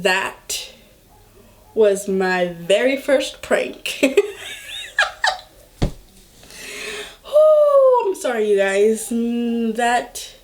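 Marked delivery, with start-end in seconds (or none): 4.0-5.4 high-pitched giggling burst; real laugh. Real, not acted. Storyteller revealing her fake story, high real laugh. Cracks up after revealing the prank. 7.3-8.0 loud, high "ooh" after laughing; high whoop. Real, not acted. Storyteller catching herself after the prank, high whoop.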